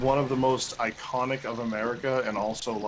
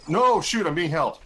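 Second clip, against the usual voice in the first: panicked; 5 semitones higher, 6 dB louder than usual. Real, not acted. Panicking in game.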